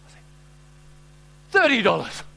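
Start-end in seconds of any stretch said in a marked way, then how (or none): 1.5-2.2 louder, higher indignant exclamation; mock-outraged, with a falling end; indignant shock. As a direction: balk at an outrageous price. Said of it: "the more indignant you look".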